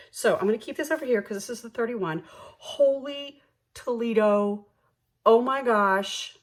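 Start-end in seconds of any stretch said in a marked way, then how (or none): 2.6-6.4 slow, higher, louder exclamation; thrilled. Real, not acted. Counting her savings, she hits her goal, thrilled. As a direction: stretch out the amazement.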